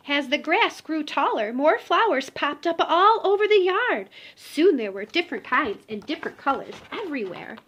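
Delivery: gentle storybook read-aloud; reading to children. Acted. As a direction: tell it like a bedtime picture book. Reading picture book aloud.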